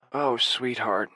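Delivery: slow, tender comfort; comforting a sobbing partner, very slowly. A narrator's reading. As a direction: soothe him gently. Comforting his crying partner.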